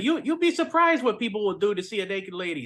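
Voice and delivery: high, laughing nerd impression; squeaky mock nerd, giggling through it. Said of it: "it's the nerd voice".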